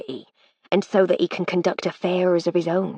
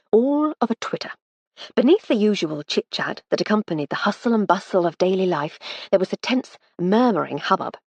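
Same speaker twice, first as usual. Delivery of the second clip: ordinary delivery, nothing marked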